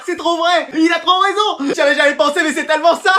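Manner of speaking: high, gushing amusement; laughing agreement. Acted. Classmates enjoying a jibe, laughing agreement. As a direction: agree wholeheartedly through laughter.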